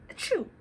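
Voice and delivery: a loud high Achoo sneeze; mock sneeze. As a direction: sneeze it out for the kids. Acting bear's sneeze.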